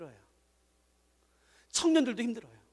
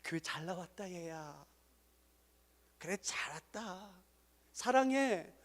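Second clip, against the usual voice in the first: very soft, slow tender welcome; gentle welcome; 3 semitones lower, 13 dB quieter, slower than usual. Shown as a demonstration. Pastor showing how to greet the boy, softly and lovingly. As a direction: embrace the boy with your voice. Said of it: "그렇게 하지 마세요".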